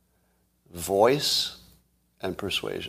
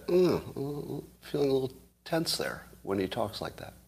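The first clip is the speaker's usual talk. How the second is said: uneasy listener; 4 semitones higher, 8 dB quieter than usual. Retold or imitated. Voices the listener's uneasy reaction.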